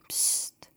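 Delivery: soft shush. A narrator's reading. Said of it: "macht sie".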